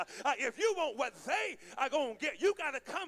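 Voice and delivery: high, forceful pulpit cadence; impassioned preacher in full flow; fervent preaching. Real, not acted. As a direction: drive each phrase up at the congregation. Preacher drives the point home.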